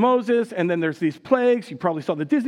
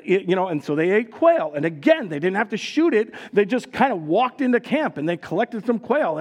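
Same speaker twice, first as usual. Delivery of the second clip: ordinary delivery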